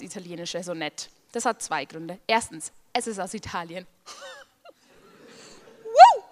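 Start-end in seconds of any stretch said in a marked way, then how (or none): 5.8-6.2 high loud whoop; cheering. Acted. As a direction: whoop like a fan. Cheering for Italy.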